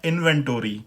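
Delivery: इन्वेंटरी as in-ven-TOR-ee, full o; American pronunciation. Shown as a demonstration. Teacher shows how Americans differ, American pronunciation. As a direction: sound out tory at the end of इन्वेंटरी. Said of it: "American इसकी pronunciation है".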